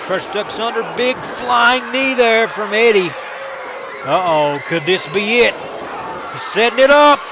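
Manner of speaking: live wrestling play-by-play, rising to shouts at the finish; wrestling commentator. Acted. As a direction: call the match as it happens.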